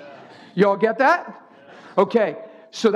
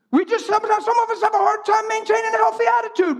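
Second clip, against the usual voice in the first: much higher, fast, shouted; preached shout; 13 semitones higher, faster than usual. Shown as a demonstration. Preacher repeats his point to the congregation, shouting. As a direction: belt the line out like a preacher. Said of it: "repeats line, second take shouted".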